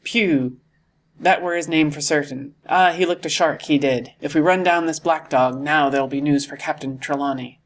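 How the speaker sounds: ordinary delivery, nothing marked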